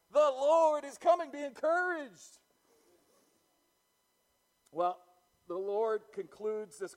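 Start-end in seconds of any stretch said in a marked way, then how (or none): 0.1-1.3 "coming" lifted higher and louder, ringing up; louder repeat. Acted. Pastor crying out the Lord's coming to the church, louder second time. 1.3-2.4 high, loud, ringing pulpit voice; preaching at full force. Acted. Pastor rousing the church about the Lord's coming, full-force preaching.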